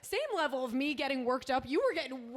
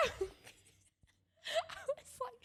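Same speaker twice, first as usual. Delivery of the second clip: laughing; 6 dB quieter, much slower than usual. Real, not acted. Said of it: "woke up so freaked out".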